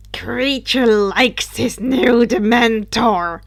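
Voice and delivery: a hint of an evil elf, slow; evil grin. House elf gloats over his new Dementor, evilly. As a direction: savour it with a wicked grin. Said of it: "grinned evilly".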